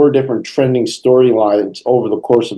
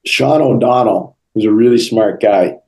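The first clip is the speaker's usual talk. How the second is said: usual speaking voice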